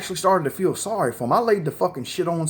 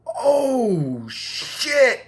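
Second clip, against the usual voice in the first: high, drawn-out "oh shit"; horrified shock; 8 semitones higher than usual. Retold or imitated. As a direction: re-enact a horrified discovery. Horrified reaction retold.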